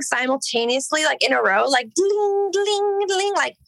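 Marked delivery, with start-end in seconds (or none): 1.9-3.3 high, ringing imitation of blinking lights; ding out each blink up high; high-pitched dinging. Guitarist imitates pedal lights blinking in a row, high-pitched dinging.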